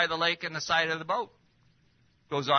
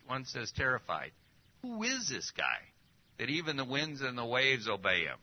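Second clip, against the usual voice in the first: usual speaking voice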